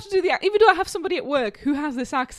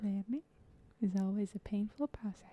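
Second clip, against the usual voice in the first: put-on very soft, tentative Indian-sounding accent; accidentally Indian-sounding accent; 4 semitones lower, 14 dB quieter than usual. Player reluctantly repeating the quote, soft Indian-sounding accent. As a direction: murmur a hesitant accent that drifts toward Indian. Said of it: "it sounds Indian".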